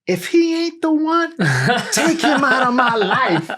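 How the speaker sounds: high, loud fervent pleading to the Lord; wife praying with fire, high and loud; fired-up prayer. Retold or imitated.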